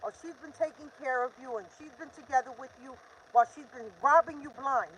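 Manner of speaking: ordinary talk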